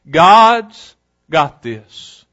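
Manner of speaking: drawn-out, deliberate; slow emphatic refrain. Shown as a demonstration. Preacher driving home his theme, very slow. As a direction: lay each word down slowly.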